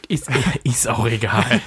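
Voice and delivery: slow, amused words through laughter; real giggle breaking into the words; laughing. Real, not acted. Laughs while dropping the topic.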